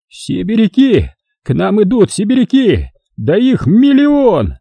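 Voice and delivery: high, slow horrified shout; alarmed cry. A narrator's reading. Veteran warning the trench, high horrified cry. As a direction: cry out the danger in dread. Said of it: "От крика ветерана".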